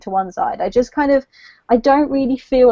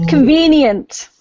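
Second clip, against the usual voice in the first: sarcastic; 5 semitones higher, 6 dB louder, slower than usual. Real, not acted. Sarcastic verdict on plot.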